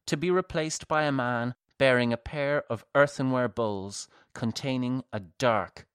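ordinary delivery

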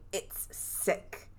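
breathy voiceless whisper of a ghost woman; ghostly whisper. Retold or imitated. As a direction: whisper it like a faint spirit recording. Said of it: "recording of a woman saying".

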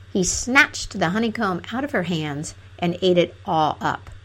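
plain reading voice

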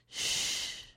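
unvoiced hush; a breathy shh to silence it; whispered shush. Shown as a demonstration. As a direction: shush the noise away. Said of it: "Perfect time to exercise Psalm forty-six ten".

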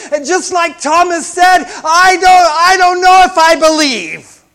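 shouted, high pulpit cry; impassioned preaching. Acted. Preacher acting out Thomas pleading with Jesus, impassioned full-force shout. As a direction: cry out the plea for faith at full volume.

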